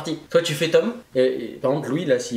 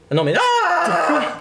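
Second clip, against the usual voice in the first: drawn-out silly voice; 14 semitones higher, 4 dB louder, much slower than usual. Pretend. Drawn-out mock voice.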